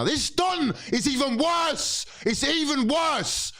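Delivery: high, slow, strained outrage; exasperated outrage. Real, not acted. Fan raging that the new manager is worse, high strained outrage. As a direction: stretch it out in disbelief.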